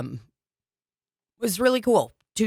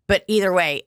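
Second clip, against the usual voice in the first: ordinary talk, nothing marked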